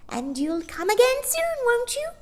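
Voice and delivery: gracious farewell; high old woman farewell. A narrator's reading. Old shopkeeper bidding Mary Poppins goodbye.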